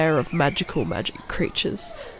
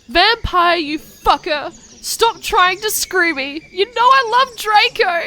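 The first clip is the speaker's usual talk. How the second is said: indignant shriek; 13 semitones higher than usual. Acted. Ebony yelling at Vampire, high outraged shriek. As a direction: squeal in outrage.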